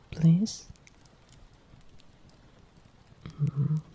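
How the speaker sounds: tender partner whispering at night; hushed bedtime partner; whispering girlfriend roleplay. Acted. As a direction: coax a sleepy loved one in a whisper.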